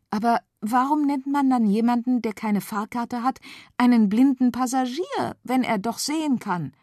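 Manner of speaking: put-on high, curious girl voice. A narrator's reading. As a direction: wonder aloud. Feli asks.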